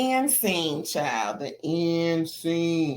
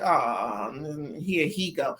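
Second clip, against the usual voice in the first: low, fed-up mock sigh of a reply; weary groan; 7 semitones lower, 5 dB quieter, slower than usual. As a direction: drop low like he's had enough. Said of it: "Glendale was like".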